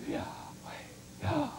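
voiceless inhale on Yah, exhale on weh; breathed name. Shown as a demonstration. Preacher illustrating praise in every breath, breathed whisper. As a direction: let breathing alone form the word. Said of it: "every time we breathe".

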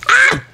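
high, loud shriek of fright; sudden piercing yelp; startled scream. Real, not acted. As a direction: scream as if jumped from behind. Enemy startles the player.

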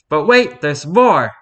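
high, loud TV pitchman; infomercial voice. Pretend. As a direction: sell it like a late-night ad. Infomercial parody.

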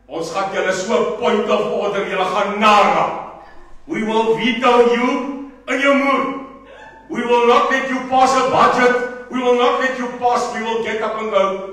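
loud, high, fired-up threats; rally the crowd with defiant vows; fiery rally-style warning; defiant shout. Acted. Threatening rivals with disruption.

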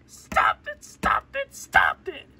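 very high, squeaky repeated command; squealed protest. Pretend. Child telling someone to stop, high squeal. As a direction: squeal each one in a high voice.

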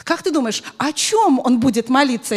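normal talking voice

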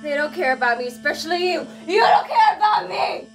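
sobbing scream. Acted. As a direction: wail it through tears at him.

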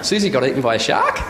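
serious news with a rising question tune at the end; Australian upward inflection on bad news; uptalk. Shown as a demonstration. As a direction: let "shark" lift up like a question. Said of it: "we all go up at the end of every sentence".

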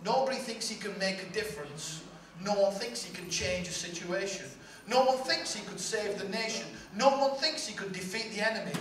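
usual speaking voice

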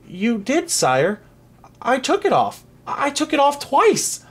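put-on very high, pleading boy; high-voiced, urgent defence; anxious protest. A narrator's reading. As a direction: insist on innocence, pitch climbing. Frightened boy protests to king.

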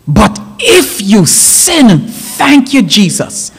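high, ringing sermon delivery; impassioned preaching. Acted. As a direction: preach it with mounting fervor, pitch lifted. Preaches the verse with rising force.

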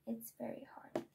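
soft unvoiced whisper. Real, not acted. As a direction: breathe it out as a whisper. Whispered complaint after stumbling.

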